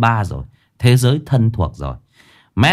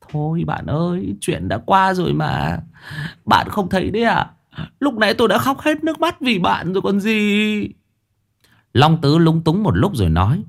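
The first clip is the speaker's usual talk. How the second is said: higher, whiny joking voice; whiny friend; 6 semitones higher than usual. Acted. Long Tứ fretting to Minh Hải, higher whiny voice. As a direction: tease and fret.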